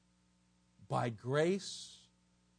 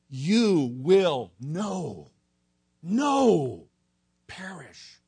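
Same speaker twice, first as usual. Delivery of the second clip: slow, heavy stress on "no, no"; spaced-out, forceful "no, no"; emphatic double negative; 7 dB louder, much slower than usual. Shown as a demonstration.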